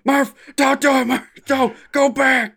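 high, loud, slow mock anguish; desperate cry. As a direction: plead across the void. Quoting Interstellar meme.

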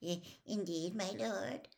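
hesitant, lightly voiced underling; a hint of a halting servant; servant figure. A narrator's reading. Wormtail answering his lord, a lightly voiced hesitant servant. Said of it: "said Wormtail".

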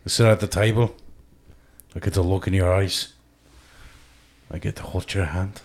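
put-on soft, halting Scottish accent, fading to whisper; tender mock-Scottish wooing, trailing away; Braveheart Scottish accent. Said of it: "I'll, I'll do Braveheart".

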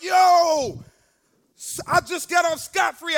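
loud, high, drawn-out yo; excited relief. Retold or imitated. Young man off the hook, loud excited exclaiming. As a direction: exclaim it like you got away with it. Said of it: "instead of being excited".

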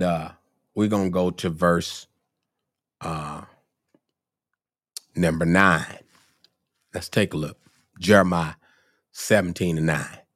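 normal talking voice

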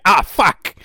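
a sudden hurt curse in the dark; mock pained yelp. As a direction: yelp as if you just banged into something in the dark. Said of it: "every now and then a bang and".

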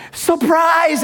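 spring the big news on them; excited surprise. Retold or imitated. Prize crew shouts surprise at the winner's front door.

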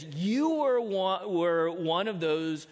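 slow, drawn-out accusing words; a servant girl slowly pinning Peter down; slow accusation. Acted. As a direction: lay the charge out slowly. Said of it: "looks at him closely and says".

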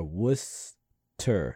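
Worcester drawn out slowly as WOO-ster; Worcester, slow and clear, like Wooster; slow careful pronunciation. Shown as a demonstration. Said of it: "W O O S T E R".

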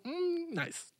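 soft, high savouring hum; relishing. Acted. Storyteller reacting to the bloody rooster, mock relishing. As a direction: hum high, then drop into quiet approval. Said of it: "Ich war so".

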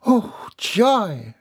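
high, delighted exclamation; joy. A narrator's reading. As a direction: let the joy leap up high. Child allowed to sleep in.

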